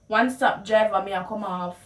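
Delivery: calling out to the bus driver in Patois; Jamaican Patois. Shown as a demonstration. Passenger calling to the driver, in Jamaican Patois. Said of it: "how you would translate the words".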